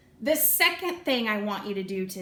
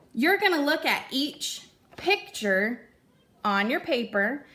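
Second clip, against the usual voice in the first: ordinary delivery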